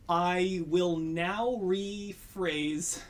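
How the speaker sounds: slow, deliberate word by word; careful slow rephrase. Shown as a demonstration. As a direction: weigh every word, avoiding T sounds. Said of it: "I have to rephrase".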